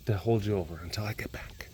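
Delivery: low, near-whispered promise; hushed and low; soft reassurance. Real, not acted. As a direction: murmur it gently to a pet. Quietly reassuring the pet.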